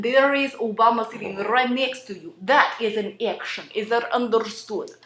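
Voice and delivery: thick Russian-accented English. Shown as a demonstration. Russian acting teacher telling students what an action is, Russian accent. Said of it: "the Russian accent".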